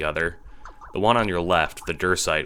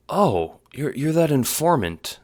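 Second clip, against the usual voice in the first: higher-pitched startled realization; dawning recognition; 10 semitones higher, 4 dB louder than usual. A narrator's reading. Prospidian recognizing the renegade, surprised. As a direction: place them suddenly, voice jumping up. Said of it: "Recognition blooms on her face".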